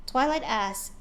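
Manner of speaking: usual speaking voice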